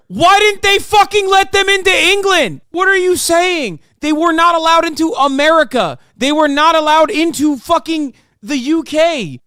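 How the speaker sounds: loud, high, furious questions; outraged. Real, not acted. Streamer furious at the debater's Holocaust claim, shouting.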